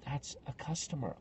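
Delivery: very soft, discreet aside; whispered warning. Retold or imitated. Friend corrects a mistaken visitor, whispering discreetly. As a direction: murmur it so only your friend hears. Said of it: "And then she whispered to me".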